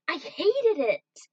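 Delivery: loud, slow, high-pitched cry of anger; angry outburst. Real, not acted. Fan shouting out how much the betrayal angered him, furious. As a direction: let the anger burst out, dragging each word.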